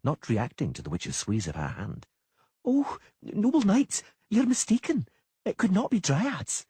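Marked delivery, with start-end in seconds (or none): 2.7-6.7 put-on much higher, slow frightened girl; trembling little girl. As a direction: plead like a scared child. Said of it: "in a trembling voice".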